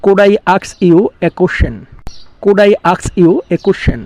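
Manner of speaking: very slow, careful English; slow model sentence. Shown as a demonstration. Teacher giving the English translation, slow and deliberate. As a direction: lay each word out slowly. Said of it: "আমি এখানে লিখতে পারি".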